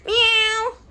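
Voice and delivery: high, loud mock meow; squeaky imitated cat meow. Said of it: "I wonder what's that cat noise".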